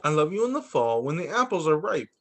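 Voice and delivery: ordinary talk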